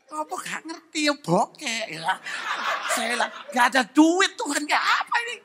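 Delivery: squeaky mock complaint, laughing; laughing mock protest. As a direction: protest to God through laughter. Retold laughing complaint to God.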